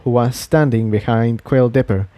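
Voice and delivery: ordinary delivery, nothing marked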